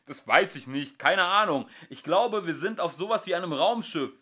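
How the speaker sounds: loud, high answer; shouting. Acted. Ford answering Arthur loudly amid the custard. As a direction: shout it over the chaos.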